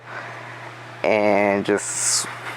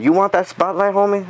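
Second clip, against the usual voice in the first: challenging taunt; 7 semitones higher than usual. Retold or imitated. Worker confronting the bully at the counter, high taunting challenge. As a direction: call him out, daring him. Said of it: "I was like".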